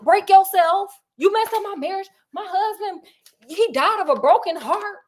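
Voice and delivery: high, sassy, accusing rant; furious widow. Retold or imitated. Widow confronting the blackmailer who ruined her marriage, furious. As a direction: tell him off for ruining your marriage. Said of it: "And she's like".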